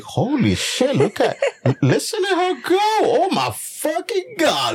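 high, laughing amazement at what he hears; amazed excitement. Retold or imitated. Husband retelling his excited reaction.

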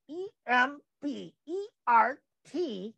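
slow letter-by-letter spelling; careful dictation of the address; spelled out slowly. Shown as a demonstration. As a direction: spell each letter so viewers catch it. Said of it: "to Embert".

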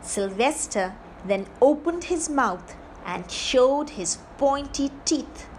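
ordinary delivery

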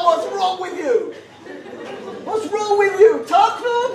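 high, slow exasperated rebuke; high, slow exasperated scolding. Retold or imitated. Outraged rebuke.

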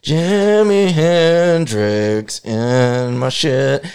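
put-on very slow, stubborn drunk voice; slow mock drunk resolve; slow drunk drawl. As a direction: drag it out like he can't be stopped. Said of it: "He's like".

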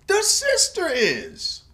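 high, slow, drawn out the sister is; emphatic. Real, not acted. Recapping the show, insisting high and slow. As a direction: lean hard on the sister.

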